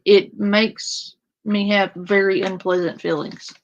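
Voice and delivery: ordinary delivery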